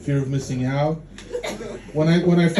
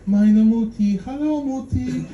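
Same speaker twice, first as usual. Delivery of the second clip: put-on child's sweet voice; 8 semitones higher than usual. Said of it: "She's like".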